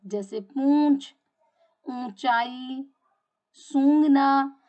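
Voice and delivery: पूंछ, ऊंचाई, सूंघना said slowly with the vowels drawn through the nose, सूंघना loud; nasal demo words. Shown as a demonstration. Teacher showing students nasal vowels through the nose, slow and drawn out. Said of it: "उच्चारित करने में हमें मुंह के साथ साथ नाक की सहायता".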